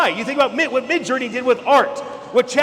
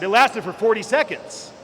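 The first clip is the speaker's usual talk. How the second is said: ordinary delivery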